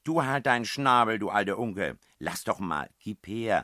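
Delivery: loud, high squabbling; irritated retort. A narrator's reading. Princess telling the old croaker to shut up, loud and irritated. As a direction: shut the heckler up and demand the paper. Princess snaps back at heckler.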